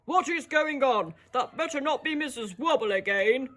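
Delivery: a touch of an indignant yell; grumpy manager demanding; cross manager. Shown as a demonstration. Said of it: "he's shouting it".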